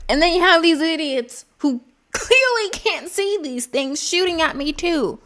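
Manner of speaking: normal talking voice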